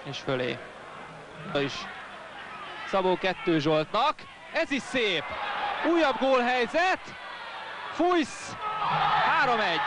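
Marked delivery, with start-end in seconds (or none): 4.5-9.8 excited goal call. Real, not acted. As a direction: yell the scorer's name. Goal called in live commentary.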